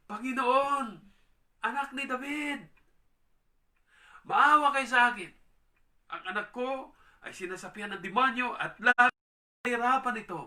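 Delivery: loud, high desperate plea for mercy; a mother's loud, high cry for mercy; pleading cry. Acted. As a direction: cry out to the Lord for your child. Preacher voices woman's cry.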